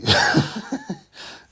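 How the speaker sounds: bright rising laugh; high laugh. Real, not acted. Laugh after intro.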